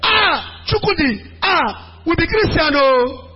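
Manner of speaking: high, wailing mock protest; mock weak plea. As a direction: whine it out like a helpless sister. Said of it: "you will not say".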